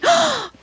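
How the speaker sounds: theatrical gasp. Retold or imitated. Canadian woman bursts into French.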